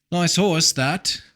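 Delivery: put-on British-accented admiring remark.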